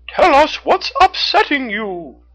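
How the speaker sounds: very high, loud, slow raccoon; insistent raccoon. A narrator's reading. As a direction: insist on an answer, pitched high and drawn out. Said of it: "Red the raccoon insisted".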